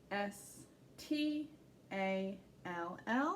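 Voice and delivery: letters spelled slowly; slow spelling. Shown as a demonstration. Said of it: "spelling letter by letter".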